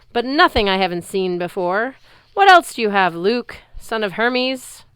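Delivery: loud, building mocking bellow; bellowing giant. Giant taunting Luke before the crowd, loud bellow. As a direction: taunt him across the stands. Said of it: "he bellowed".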